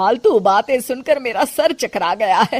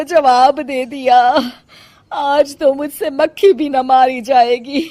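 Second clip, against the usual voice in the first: higher, loud aching moan; groaning in pain; 6 semitones higher, 3 dB louder than usual. Acted. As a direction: whine about sore back and legs. Complains of aching back and legs. (दर्द से कराहती हुई आवाज़)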